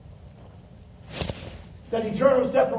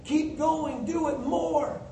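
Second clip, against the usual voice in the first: higher-pitched coach's urging; pep talk in a raised pitch; encouraging coach; 7 semitones higher, slower than usual. Acted. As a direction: spur the team on like a coach. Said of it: "As the coach would to a team".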